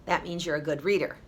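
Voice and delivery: plain reading voice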